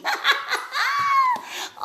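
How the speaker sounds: squealing high laugh; high delighted laugh. Real, not acted. Vlogger laughing at her own word hunt, high laugh.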